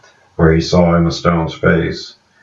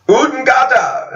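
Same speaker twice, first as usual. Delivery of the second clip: strange shouted word. Retold or imitated. Man cries out to the stunned group, high ringing shout. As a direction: belt it out like a war cry.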